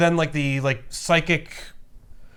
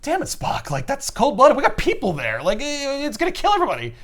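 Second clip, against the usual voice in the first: high-pitched indignant objection; Bones protesting; 10 semitones higher than usual. Acted. Bones objecting to Spock's plan, indignant. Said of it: "and Bones is like".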